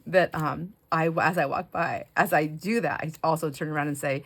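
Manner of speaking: normal talking voice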